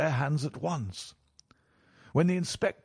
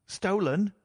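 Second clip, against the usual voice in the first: higher, rising incredulous question; cried out; 6 semitones higher than usual. A narrator's reading. Accused son to his father, startled cry. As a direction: throw the word back as a startled question. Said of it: "he cried".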